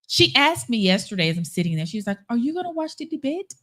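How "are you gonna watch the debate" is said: a mild imitation of the Korean nail tech's accent. Nail tech asking about the debate, mild Korean accent. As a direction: lightly put on the Korean manicurist's accent, asking. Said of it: "you ain't had to put that accent in there".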